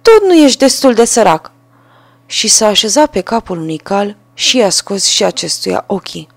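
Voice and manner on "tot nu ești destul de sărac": high, loud taunt stressing Tot; a little bird jeering down at him; mocking sparrow. A narrator's reading. Sparrow taunting the ruined carter, high jeer. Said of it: "Dar Vrabia i-a răspuns".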